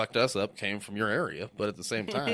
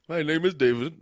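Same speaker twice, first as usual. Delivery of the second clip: put-on louder, higher Indian-accented introduction; mock Indian call-centre accent; 5 semitones higher, 6 dB louder than usual. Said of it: "Indian, one of those fucking telemarketers".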